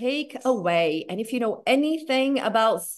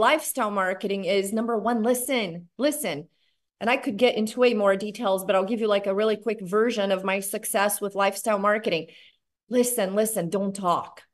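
ordinary delivery

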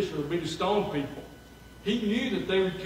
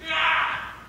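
scream; 13 semitones higher, 5 dB louder than usual. Retold or imitated. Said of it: "they're like".

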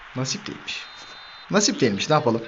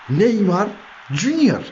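quick, higher excited shout with Junior hitting a peak; high excited call; 7 semitones higher, much slower than usual. Real, not acted. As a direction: fire the name out in excitement. Repeated goal call, higher. (tiz ve heyecanlı gol çağrısı)

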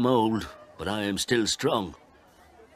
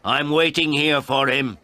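put-on raised, higher old man's shout; shouting at her; 8 semitones higher, 7 dB louder than usual. A narrator's reading. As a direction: call out angrily through the closed door. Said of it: "I shouted at her".

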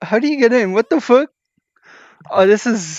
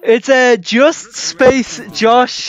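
slow clarifying; 3 semitones higher, much slower than usual. Real, not acted. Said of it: "How do you say it?".

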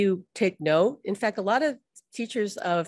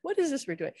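much higher, puzzled subconscious; confused surprise; 6 semitones higher, faster than usual. Retold or imitated. The subconscious puzzled by new habits, much higher and bewildered. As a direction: sound baffled by the new habit. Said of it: "It's going".

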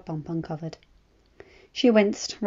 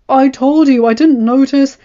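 louder, higher defensive reply; husband justifying himself, raised voice; defensive retort; 6 semitones higher, 15 dB louder than usual. A narrator's reading. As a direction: argue back in self-defence.

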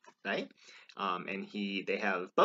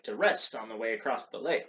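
usual speaking voice

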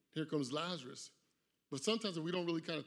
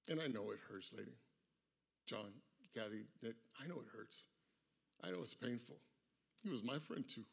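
softer, lower words to the family; tender comfort; 6 semitones lower, 10 dB quieter than usual. Real, not acted. Pastor speaking to the grieving family, soft and tender. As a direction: console the grieving parents gently.

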